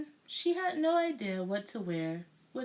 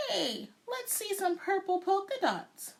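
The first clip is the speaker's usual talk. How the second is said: put-on high, eager kid's call; yelled out; 10 semitones higher than usual. A narrator's reading. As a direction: call it out across the class. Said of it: "One yelled out".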